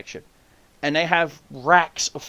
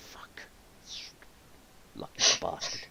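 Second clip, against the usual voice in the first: muttered curse; 11 dB quieter than usual. Retold or imitated. Muttered curse at lost moose.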